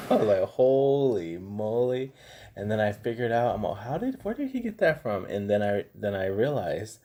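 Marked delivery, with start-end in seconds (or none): 0.4-2.1 slow, drawn-out amazed exclamation; stunned. Retold or imitated. Reaction to son's fear. 3.8-5.2 much higher, baffled question; puzzled. Retold or imitated. Inner puzzled thought.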